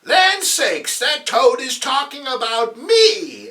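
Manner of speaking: put-on high, loud, shocked old aunt cry; appalled Aunt May. Aunt May appalled at the TV, high outraged squeal. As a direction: gasp it out like an outraged granny. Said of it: "Aunt May is appalled".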